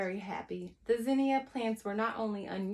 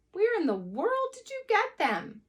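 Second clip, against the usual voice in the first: amazed; 12 semitones higher, 6 dB louder than usual. Acted. Neighbour marvels at the zinnias.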